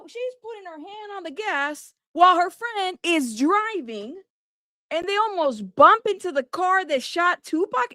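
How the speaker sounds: normal talking voice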